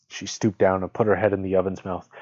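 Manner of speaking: ordinary delivery, nothing marked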